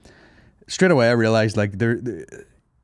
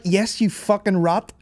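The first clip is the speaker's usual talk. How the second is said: mock angry retort; 9 semitones higher, slower than usual. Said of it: "You're like".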